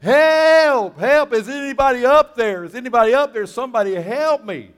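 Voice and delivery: desperate high shout for help; hammed-up cry for rescue. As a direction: yell up from the cliff, panicked. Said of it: "he starts crying out for help".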